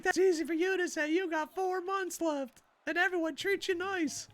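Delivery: high-pitched imitation of an upset coworker; mock crying. Stressed teacher to retiring colleague, high mock-tearful complaint. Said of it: "they're crying".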